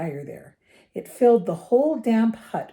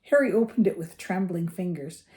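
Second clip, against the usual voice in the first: usual speaking voice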